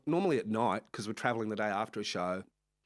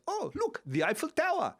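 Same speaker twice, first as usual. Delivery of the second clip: much higher, bright tour-guide call; imitating a cheery driver. Driver pointing out the Eiffel Tower on the way to the airport, high and chirpy. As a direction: point it out too late, offhand.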